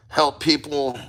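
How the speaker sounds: normal talking voice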